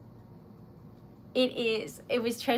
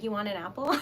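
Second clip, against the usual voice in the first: evil witch; faster than usual. Retold or imitated. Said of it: "the evil witch who's like".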